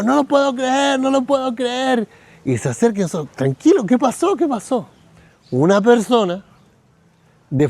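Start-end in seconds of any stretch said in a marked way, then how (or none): 0.0-2.1 high-pitched cry of disbelief; imitated yelling. Preacher mimicking his stunned friend at the meeting, yelling high. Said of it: "Y empieza a gritar". 3.4-4.9 urgent questioning. Retold or imitated. Friends rush to the shouting man.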